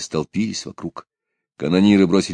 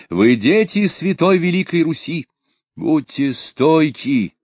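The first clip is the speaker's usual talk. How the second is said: roaring rallying speech; 6 semitones higher than usual. A narrator's reading. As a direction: rouse the troops like a tsar. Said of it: "обратился к солдатам со следующей речью".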